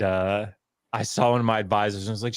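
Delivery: ordinary talk, nothing marked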